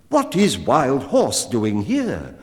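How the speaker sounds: slow, loud, puzzled man's voice; a hunter startled to find a horse at home; the man, surprised. A narrator's reading. Man back from hunting asks about the horse, loud and slow.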